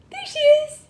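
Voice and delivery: squealed very high and loud; peekaboo reveal. Pretend. Mom surprising her baby at peekaboo, a high delighted squeal.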